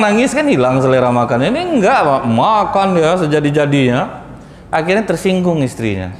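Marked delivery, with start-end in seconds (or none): none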